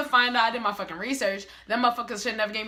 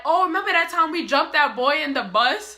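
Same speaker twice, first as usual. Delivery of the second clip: high, louder imitation of eager storytelling; put-on upbeat, raised voice; reminiscing sister; 6 semitones higher, 6 dB louder than usual. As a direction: mimic a chirpy "remember when". Mimics sister starting a story.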